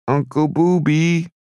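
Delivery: put-on high, drawn-out child's plea; little girl's long, wheedling appeal; pleading. A narrator's reading. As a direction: stretch the name out high, begging. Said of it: "she said, pleading".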